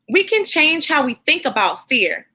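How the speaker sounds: plain reading voice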